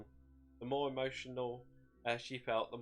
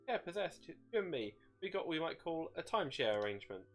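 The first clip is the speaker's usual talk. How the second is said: higher, smug taunting voice; gloating demon voice; 7 semitones higher than usual. A narrator's reading. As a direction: rub it in with glee. The shade gloating.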